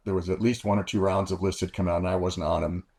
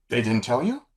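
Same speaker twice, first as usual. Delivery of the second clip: imitated woman, raised surprised question; higher surprised woman; 5 semitones higher than usual. Liaison surprised pilot wasn't told, raised voice. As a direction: play her amazed he doesn't know.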